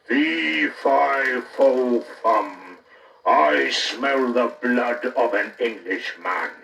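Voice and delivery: slow, loud giant's boom; the unseen giant bellows from above. A narrator's reading. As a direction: rumble it out like a giant. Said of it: "It shouted loud".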